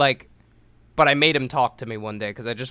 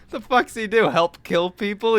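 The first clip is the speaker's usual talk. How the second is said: incredulous dad; 8 semitones higher, 4 dB louder than usual. Retold or imitated. Dad alarmed by huge man.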